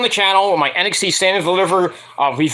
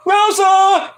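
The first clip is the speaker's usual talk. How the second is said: high slow mock call for Rosa; drawn-out high call; 13 semitones higher, much slower than usual. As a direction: holler across the house. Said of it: "he's like".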